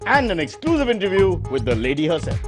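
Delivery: punchy headline read over music; parody news anchor. Acted.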